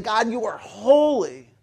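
slow, loud declaration; angels proclaiming. Acted. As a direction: proclaim it with deliberate weight on each word. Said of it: "So they're saying".

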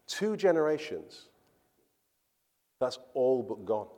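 ordinary delivery

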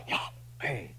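Yahweh said unvoiced, like a breath. Shown as a demonstration. Teaching the congregation how Yahweh sounds, breathed. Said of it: "It's like a breathing noise".

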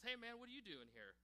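mock-surprised teen hello; surprised greeting. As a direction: pitch it up like a kid spotting someone unexpected. Student greets leader in story.

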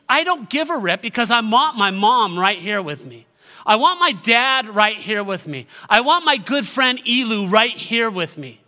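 acted grief-stricken outburst; anguished protest. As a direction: cry out as the bereaved, demanding their loved ones. Voicing a grieving person's protest.

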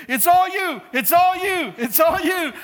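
high, loud cheering shout; retold yelling. Spotter cheering on a failing lifter, imitated yelling. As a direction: yell like an over-eager spotter. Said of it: "then would start yelling".

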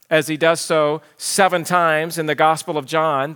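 normal talking voice

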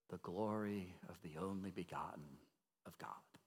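breathy, hushed whisper of wonder; awed whisper. Real, not acted. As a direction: let the words fall to a whisper in awe. Awed description of God's glory.

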